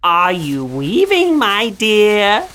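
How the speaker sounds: high, slow sing-song witch voice; high drawn-out crone calling through the window; witch asking sweetly. A narrator's reading. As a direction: coo it like a crafty old witch. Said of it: "She went to the window and asked".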